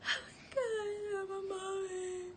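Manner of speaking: very high, slow, tearful cry; overwhelmed new mother, voice breaking; crying with joy. Retold or imitated. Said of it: "And I was like".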